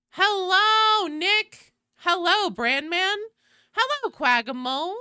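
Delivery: high, loud chirpy hellos to chat; sing-song greeting. Real, not acted. Streamer greeting chat names in a high sing-song. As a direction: greet each viewer brightly, sing-song.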